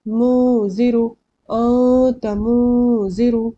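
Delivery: मुजिरु and अंत drawn out, each n hidden as a nasal hum; slow अंत मुजिरु, holding the noon in the nose; correct ikhfa. Shown as a demonstration. Teacher modelling correct pronunciation, slow nasal holds. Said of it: "ये हो गया correct pronunciation".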